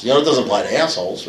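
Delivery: ordinary talk, nothing marked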